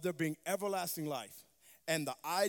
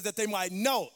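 "know" punched louder at the end; stress on know; 5 dB louder than usual. Shown as a demonstration. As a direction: hit the word "know". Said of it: "Emphasis on the word know".

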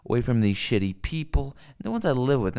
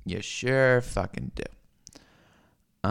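slow drawn out; much slower than usual. Real, not acted. Repeated line, slowed.

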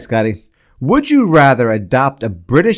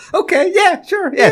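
very high-pitched squeaky assent; mock agreement; slower than usual. Mock agreement to having fun.

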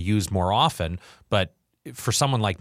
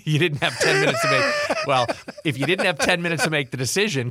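high squeaky laugh through words; 7 semitones higher, 3 dB louder than usual. Real, not acted. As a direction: laugh while asking, incredulous. Laughing incredulous reply.

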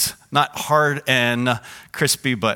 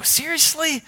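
much higher incredulous question; surprised; 13 semitones higher than usual. Retold or imitated. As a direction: sound truly amazed. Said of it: "And I go".

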